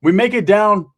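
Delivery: ordinary delivery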